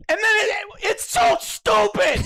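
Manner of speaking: high, outraged shouting; real angry yelling. Real, not acted. Reviewer ranting about Superman's lore, angry shouting. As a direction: yell it in exasperated disbelief. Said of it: "Why are you fucking yelling, dude?".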